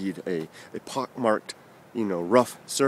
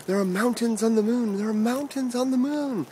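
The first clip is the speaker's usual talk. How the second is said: high, sustained mock yell; excited yelling; 12 semitones higher than usual. Boy yelling news through parents' door, excited high mock yell. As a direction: call it out high, drawing out the last word. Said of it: "pounding on the door and yelling out".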